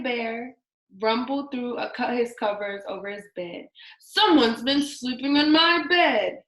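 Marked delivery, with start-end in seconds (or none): 4.0-6.4 Daddy Bear's light grumble, a bit louder; mock grumble. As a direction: grumble it like the big bear. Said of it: "he grumbled".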